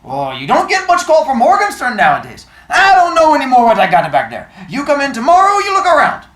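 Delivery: put-on high, loud New York immigrant shopkeeper accent; thick old-world accent. Old bookseller on the phone to a caller, thick New York accent. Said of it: "His accent was thick".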